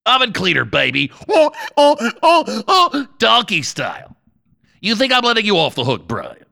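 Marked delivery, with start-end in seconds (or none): 1.3-3.1 high repeating "oh, oh"; imitated looping radio drop; homemade soundboard effect. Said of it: "I have to do the sounds myself".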